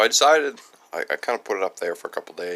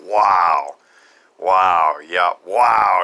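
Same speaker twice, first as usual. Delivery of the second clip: amazed wow; 11 dB louder, much slower than usual. Retold or imitated. Owner amazed by old saw.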